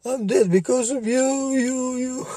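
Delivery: put-on ghostly little boy voice, very high; whiny child ghost. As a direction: wail like a dead child haunting his mother. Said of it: "he's like".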